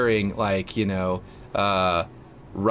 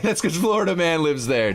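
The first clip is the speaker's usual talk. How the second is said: laughing; 7 semitones higher than usual. Real, not acted. Repeating a chat joke to viewers, laughing. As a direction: repeat it through real laughter, higher.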